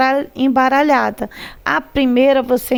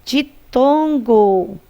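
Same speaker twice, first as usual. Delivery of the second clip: slow drawn-out answer; much slower than usual. Shown as a demonstration. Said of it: "Ela é o quê?".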